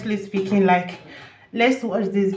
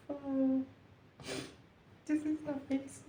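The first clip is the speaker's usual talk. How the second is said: soft, breathless awe, higher; emotional hush; 6 semitones higher, 14 dB quieter than usual. Real, not acted. Vlogger overwhelmed by the concert, soft emotional awe. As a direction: whisper the wonder to yourself. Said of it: "I'm so sorry for being emotional".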